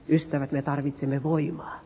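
whispered plea to the congregation; hushed whisper. Acted. Preacher whispering to the congregation about needing power. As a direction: drop to a breathy whisper, intimate.

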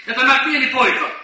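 normal talking voice

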